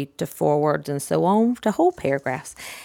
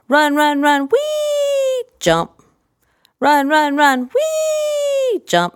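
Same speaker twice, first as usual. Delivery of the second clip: wee swooping much higher, louder play voice; sing-song run wee jump; 10 semitones higher, 3 dB louder, much slower than usual. Shown as a demonstration. Playing horse jumping the fence for a child, sing-song. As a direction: bounce it like a galloping horse. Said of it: "same kind of intonation".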